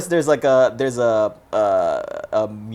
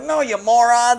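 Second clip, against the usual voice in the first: retold scolding; 8 semitones higher, 3 dB louder than usual. Acting out poacher scolding his friends.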